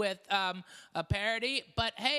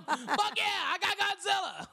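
laughing; 10 semitones higher than usual. Real, not acted. Gets his Godzilla request.

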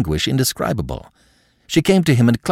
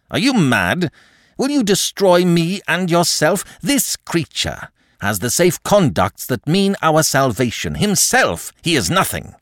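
raised pitch, urgent reproach; exasperated protest; 8 semitones higher than usual. A narrator's reading. Marquis shaking off Thérèse's clutch, exasperated. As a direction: argue heatedly, pitch jumping up. Marquis struggles with clinging woman.

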